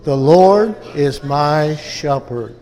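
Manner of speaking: "The Lord is my shepherd" drawn out slowly, word by word; slow declaration. Shown as a demonstration. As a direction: declare "The Lord is my shepherd" at half pace for the congregation. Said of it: "Can we say".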